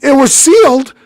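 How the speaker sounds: high, loud indignant telling; outraged disbelief. Real, not acted. Telling friend about the empty Junior Mints box, outraged. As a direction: rise up in mock outrage.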